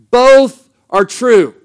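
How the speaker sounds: slow and loud, both words weighed; emphatic declaration. Acted. A preacher settling the question for the congregation, slow and loud. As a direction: lean hard on every word of it. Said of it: "And the answer to that is yes".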